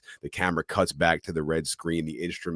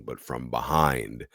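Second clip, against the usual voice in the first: normal talking voice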